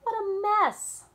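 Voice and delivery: high, loud and slow dismay; dismayed exclamation. A narrator's reading. Girl looking at scattered paints, loud dismay. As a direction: wail at the mess.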